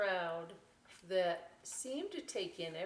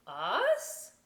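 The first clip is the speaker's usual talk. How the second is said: louder puzzled little question; surprised vulture; 9 dB louder than usual. Acted. Vulture surprised at the invitation, louder. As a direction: ask it startled.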